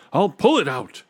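high, loud yell. A narrator's reading. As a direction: yell it with determination. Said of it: "he yelled".

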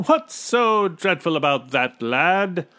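loud, high, breezy character voice; hearty, condescending officer; amused captain. A narrator's reading. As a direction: brush off the worry jovially. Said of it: "laughed the captain".

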